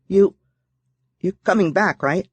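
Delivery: put-on a scared kid asking him to return; worried young girl. A narrator's reading. Sydney anxiously asking Mitch.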